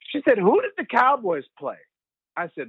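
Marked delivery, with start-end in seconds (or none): 0.4-1.8 imitating mom. Mother voiced in story.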